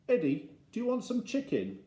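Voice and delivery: put-on high, sing-song offer to a child; coaxing parent. Dad offering Eddie picnic food, high coaxing. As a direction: offer it brightly, pitched well up.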